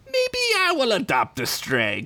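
high, sly taunt; sly Crinkles. Acted. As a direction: tease the threat out.

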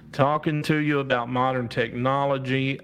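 usual speaking voice

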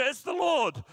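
high, loud exclamation; excited cry. Acted. Disciple telling Peter who is on the beach, excited shout. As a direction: cry it out in sudden recognition. Acted disciple recognizing risen Jesus.

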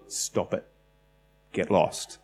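whispered, soft rebuke; quiet command. Retold or imitated. Kirk quietly telling the storm to go away, whispered. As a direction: hush the storm away under the breath. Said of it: "just quietly went".

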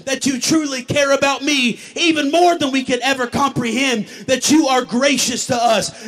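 loud, urgent pastoral prayer in a driving stream; fervent prayer. Acted. Pastor asking God to strengthen the congregation, fervent prayer. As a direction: pray at full force over the room.

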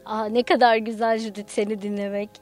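plain reading voice

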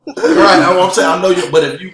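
high, loud words through real laughter; laughing agreement. Real, not acted. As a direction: agree loudly while still laughing. All laughing over the debt joke.

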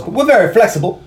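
high, sheepish backpedal; suddenly meek and eager to please. Retold or imitated. Bird caving to the driver once he sees the gun, high and sheepish. Bird's quick reversal.

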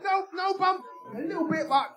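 high, squeaky mock British voice; a silly high-pitched Brit parody; mock British accent. Mocking British accent bit.

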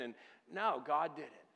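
ordinary talk, nothing marked

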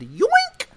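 squeaky high cartoon yoink; snatch it with a high yoink. Mimicking biker snatching phone, squeaky high yoink.

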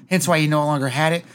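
usual speaking voice

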